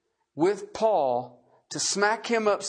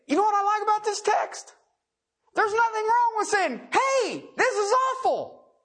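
much higher, fast, ringing preacher voice; fervent preaching; 13 semitones higher than usual. Real, not acted. Preacher rousing the congregation, high fervent call.

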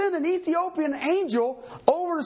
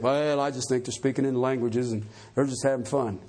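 much lower, mock easygoing churchgoer; complacent churchgoer; 12 semitones lower than usual. Preacher mimicking members who embrace it, mock complacent. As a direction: play a smiling excuse-maker, voice dropped right down.

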